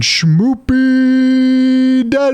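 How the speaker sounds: drawn-out playful intro. Pretend. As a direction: stretch the name out teasingly. Host introduces cohost playfully.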